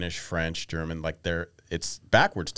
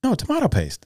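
high-pitched retold correction; mock insistence; 14 semitones higher, 4 dB louder than usual. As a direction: correct them as the stubborn customer.

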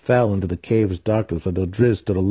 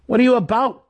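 sharp, much higher, louder demand; 4 dB louder than usual. A narrator's reading. Dinin demanding of his brother Driz, high and sharp. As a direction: demand an answer at once. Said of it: "Dinin demanded".

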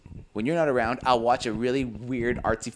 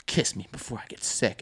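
soft, understated acted plea; quiet imitation of a lover; hushed movie line; 3 semitones lower, 7 dB quieter than usual. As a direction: murmur it like a film romance. Voicing husband's intimate reply.

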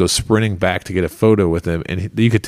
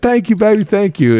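much higher, easygoing voice imitating the comedian; tossing back a breezy thank-you, pitched up high; celebrity's thanks; 12 semitones higher, 3 dB louder than usual. Comedian thanking a fan for the jacket compliment, high breezy imitation. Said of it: "And he said".

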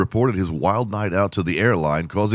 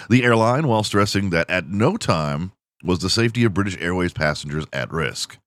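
ordinary delivery

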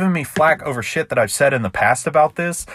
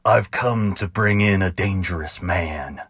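ballad narration; 4 semitones lower than usual. A narrator's reading. Narrator reading his cowboy ballad to listeners, rhythmic and sly. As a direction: spin it like a campfire tall tale.

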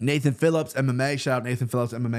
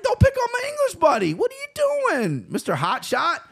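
very high, squeaky mock scolding; mock confrontation. Telling off dude bothering English buddy, hammed-up high-pitched challenge. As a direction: play at defending a friend in a silly high voice.

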